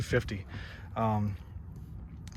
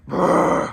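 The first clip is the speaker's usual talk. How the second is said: engine rumble imitation. Imitating aggressive truck engine.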